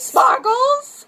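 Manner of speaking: high delighted question; surprised echo. Real, not acted. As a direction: repeat it back, thrilled. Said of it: "child answer echoed".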